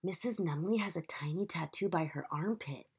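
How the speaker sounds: hushed gossip whisper; whispering classroom gossip. Acted. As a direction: keep it just between us two. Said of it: "George whispered to Blabber".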